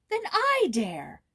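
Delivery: defiant, daring everyone; girl vowing to confront the uncle, defiant; defiant cry. A narrator's reading. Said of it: "cried Joyce with flashing eyes".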